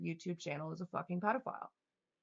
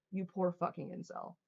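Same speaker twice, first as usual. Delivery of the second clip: ordinary talk, nothing marked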